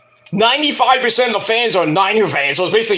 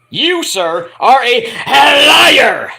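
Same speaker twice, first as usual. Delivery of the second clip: loud, cracking shout on liar; mock accusation; 6 semitones higher, 6 dB louder, much slower than usual. Calling someone a liar, ending in a loud cracking shout. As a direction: build up and yell the final word.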